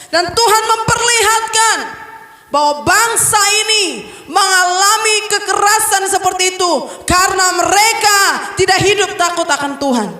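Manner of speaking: high, building preacher's cry; forceful preaching. Acted. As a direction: drive the warning home. (berkhotbah dengan semangat tinggi)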